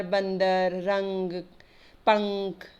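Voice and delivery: बंदर, रंग, पंख with the n hummed through the nose; teacher's careful nasal in बंदर, रंग, पंख; nasal anusvara. Shown as a demonstration. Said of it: "इसका उच्चारण नाक से होता है".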